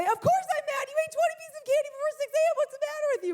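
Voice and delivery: loud, high, rapid-fire scolding; hammed-up angry yelling in one breath; exasperated parent outburst. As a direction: let loose a mock furious rant at the kid. Angry outburst she wanted to say.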